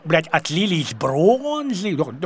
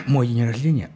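usual speaking voice

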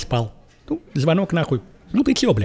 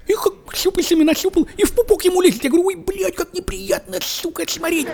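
squeamish high voice; 14 semitones higher than usual. Retold or imitated. Disgusted high-pitched retelling.

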